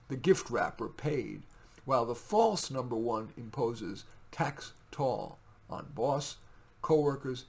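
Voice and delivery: loud, slow, declaimed poem; poetry reading. Acted. Poet reading his poem to viewers, declaimed. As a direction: read it like a spoken-word performance. Reads own poem aloud.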